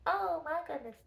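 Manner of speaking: nose held, pinched sound; pinching nose. Shown as a demonstration. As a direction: say it with the nose plugged. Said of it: "Plug your nose".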